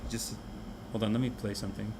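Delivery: ordinary talk, nothing marked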